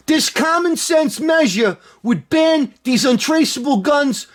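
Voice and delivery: hammed-up Cuomo impression building to a yell; impersonating the governor's press release in a New York accent. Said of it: "let me do my Cuomo accent".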